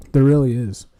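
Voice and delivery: ordinary delivery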